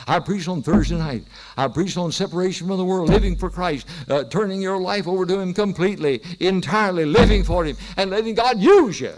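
high, loud preacher's cry, building; impassioned pulpit climax; full-force preaching. Real, not acted. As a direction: build each phrase to a ringing peak.

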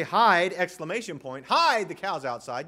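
Hide! shouted, then hide the cows outside; an alarmed call to hide at once; urgent warning. Shown as a demonstration. Warning people to hide themselves, a loud urgent Hide!. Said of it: "you mean conceal yourself".

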